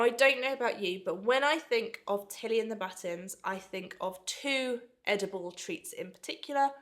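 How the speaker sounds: usual speaking voice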